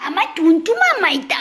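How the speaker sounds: high doting coo. Real, not acted. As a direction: coo over the pet lovingly. Cooing at pet.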